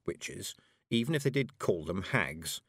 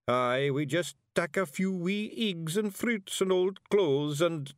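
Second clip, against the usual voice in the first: high, slowed Scottish little-man voice; artless Scots simpleton; 9 semitones higher, 3 dB louder, slower than usual. A narrator's reading. Daft warrior confesses small thefts, artless Scots. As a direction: blurt it out innocently in Scots.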